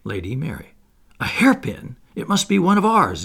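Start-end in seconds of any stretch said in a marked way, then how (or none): 1.2-3.2 much higher, louder thrilled squeal; excited. Acted. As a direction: seize on the hairpin eagerly. Daughter excited by hairpin.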